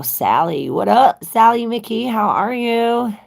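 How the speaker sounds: high, loud, delighted welcome; loud cheery hello. Real, not acted. Greeting viewer Sally, loud excited hello. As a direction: call out a happy hello.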